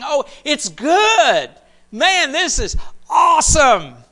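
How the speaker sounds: high, hammed-up excited shout; mock enthusiasm. A craftsman praising his own idol, mock excited shout. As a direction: cheer the idol like a proud smith.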